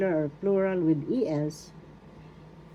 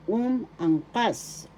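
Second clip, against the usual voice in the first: "Umangkas" slowly as "um", "ang", "kas", raised pitch; slow, separated syllables; 5 semitones higher, much slower than usual. Shown as a demonstration. Teacher breaking down umangkas for learners, slow and separated. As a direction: stretch and separate each part of "Umangkas". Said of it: "the accent is in the third syllable".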